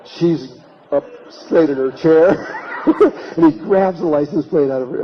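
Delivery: real laughter bursting through the telling; laughing. Real, not acted. Describing the caught boyfriend to listeners, laughing hard.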